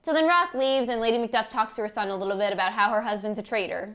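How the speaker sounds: usual speaking voice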